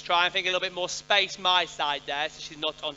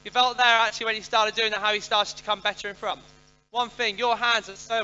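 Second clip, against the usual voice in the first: loud called-out feedback; 5 semitones higher, 7 dB louder than usual. Real, not acted. Instructor calling feedback across arena.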